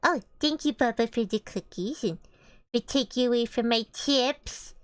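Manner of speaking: usual speaking voice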